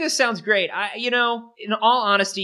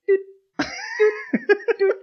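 high-pitched doot doot; squeaky little doot sounds; fish noise; much faster than usual. Retold or imitated. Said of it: "you can hear the fish going".

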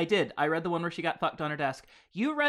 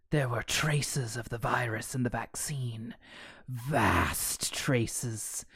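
sinister whisper; 4 semitones lower, slower than usual. Acted. Virus whispers explanation.

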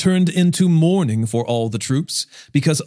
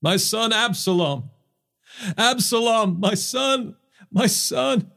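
much higher, softer grief-stricken lament; mournful crying out; 9 semitones higher, 3 dB quieter than usual. A narrator's reading. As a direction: wail the son's name from grief. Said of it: "cried loudly".

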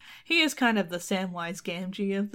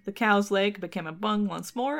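ordinary delivery

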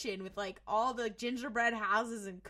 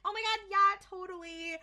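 high, eager mock agreement; put-on neighborly enthusiasm; fake-nice gushing; 7 semitones higher, 3 dB louder than usual. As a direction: gush agreement to a neighbor, pitched up. Villains acting nice to neighbor.

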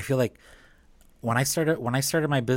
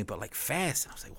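whisper; 9 dB quieter than usual. Real, not acted. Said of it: "whispered aside".

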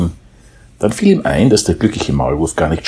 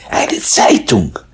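mole; 5 semitones higher, slower than usual. A narrator's reading. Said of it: "antwortete der Maulwurf".

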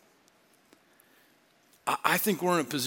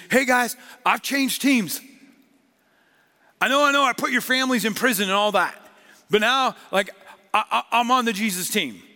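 playful Saul; 6 semitones higher, 5 dB louder than usual. Retold or imitated. Preacher voices Saul to church.

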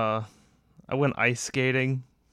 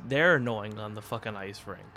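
normal talking voice

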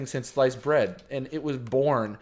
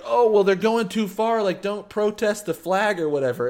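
mocking conservatives; 7 semitones higher than usual. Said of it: "there's this huge argument, like".

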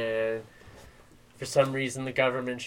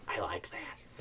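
soft unvoiced whisper, lower; 6 semitones lower, 13 dB quieter, slower than usual. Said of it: "that's what it sounded like".

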